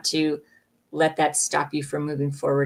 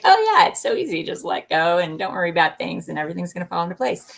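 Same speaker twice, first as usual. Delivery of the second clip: mock breezy; faster than usual. Reader imitating guidance that makes letting go sound easy, mock breezy. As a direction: toss it off as if it were effortless. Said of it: "they make it sound easy".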